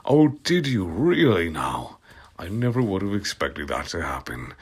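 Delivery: dry whispered aside; sarcastic whisper. Real, not acted. Reader sarcastically whispers about the crying, quiet and dry. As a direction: hush it, mocking the text. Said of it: "unvoiced whisper".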